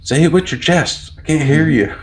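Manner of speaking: high-pitched exasperated mock yell; retold yelling. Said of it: "now I'm yelling at them".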